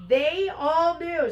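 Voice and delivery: high, loud, slow and drawn-out; acted indignant exclamation; outraged disbelief. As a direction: stretch each word in loud outrage. Heroine outraged at the secret.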